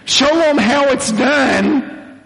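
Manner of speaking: high, drawn-out rallying call; acted cheering on. Church team calls to its best batter, cheering on. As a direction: urge the star batter on, stretching it out. Team urging Casey to bat.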